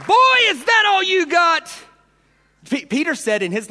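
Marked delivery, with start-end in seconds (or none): 0.1-1.7 high, loud rallying taunt; triumphant shout. Acted. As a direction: proclaim it to the congregation. Preacher repeats the taunt.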